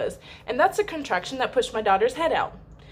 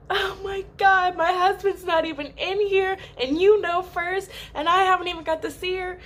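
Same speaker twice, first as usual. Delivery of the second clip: high-pitched retold whine; dismayed protest; 10 semitones higher than usual. Mother answering aunt's announcement, dismayed high protest. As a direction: protest in a high, put-on upset voice.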